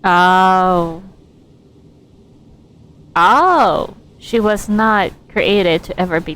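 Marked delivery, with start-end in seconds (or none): none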